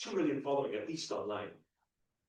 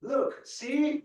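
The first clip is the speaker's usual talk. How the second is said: showing off; 12 semitones higher, 7 dB louder than usual. Retold or imitated. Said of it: "I'll say".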